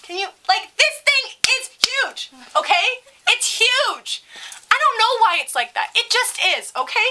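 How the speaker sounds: very high, emphatic mock outrage; exasperated rant. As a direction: protest it in a high, worked-up voice. Continues acted indignant comeback.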